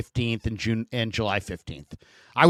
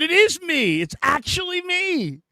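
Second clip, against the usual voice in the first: high, put-on hammed-up reply; mock answer; slower than usual. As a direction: play along with the fan in a high silly voice. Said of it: "Uh, I said".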